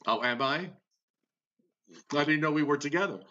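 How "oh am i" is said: normal talking voice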